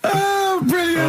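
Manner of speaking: high-pitched, laughing delight; tickled, squeaky and breaking up; delighted laughter. Real, not acted. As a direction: gasp it out through laughter. Cracks up at the cow story.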